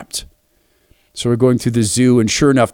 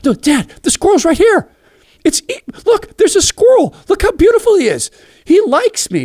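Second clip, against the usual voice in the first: put-on very high, eager child's voice; a girl insisting Dad look; much higher, louder thrilled child; excited little girl; 4 dB louder than usual. Daughter insisting on squirrel.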